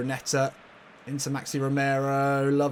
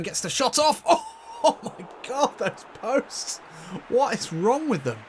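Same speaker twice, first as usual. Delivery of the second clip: high, loud cry of disbelief; excited disbelief; 10 semitones higher, 3 dB louder than usual. Real, not acted. Commentator watching the shot hit the post, high excited disbelief. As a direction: yelp in exasperated shock at a near miss.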